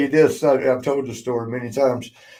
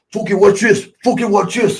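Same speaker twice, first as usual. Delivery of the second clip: higher and louder, a cocky dare; excited show-off; 7 semitones higher, 4 dB louder than usual. Retold or imitated. Davey Boy bragging to the boys, excited and high. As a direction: hype up the crowd before the throw. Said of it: "Davey Boy goes".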